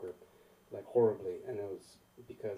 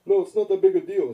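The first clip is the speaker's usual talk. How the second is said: high, loud acted reassurance; loud protest; 9 semitones higher, 18 dB louder than usual. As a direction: wave the apology away loudly. Acted reply to his apology.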